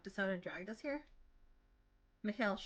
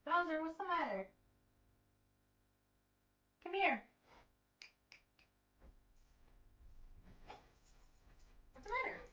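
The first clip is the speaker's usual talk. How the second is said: high coaxing pet voice; talking to a dog; 8 semitones higher than usual. Real, not acted. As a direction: coax the dog sweetly. Speaker talks to dog.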